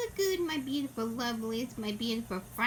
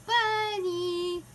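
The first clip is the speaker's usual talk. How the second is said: fond cooing pet talk; 6 semitones higher than usual. Real, not acted. Baby talk to her cat.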